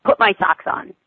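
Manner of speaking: a grumpy little grunt of a demand. A narrator's reading. Cranky child grunting at her mother for socks. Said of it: "she grunts".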